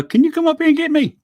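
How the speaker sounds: high, pleading ask for a ride; sheepish son. Retold or imitated. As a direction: beg Dad sheepishly over the phone. Calling Dad from jail.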